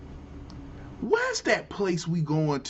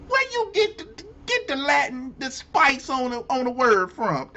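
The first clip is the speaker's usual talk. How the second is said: incredulous; 7 semitones higher, 3 dB louder than usual. Real, not acted. Incredulous rant at accent switch.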